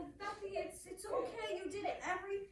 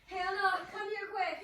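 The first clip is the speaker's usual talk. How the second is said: raised, louder call; urgent yell; 4 dB louder than usual. Acted. Girl summons housekeeper to her sick sister, urgent yell. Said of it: "why are you yelling?".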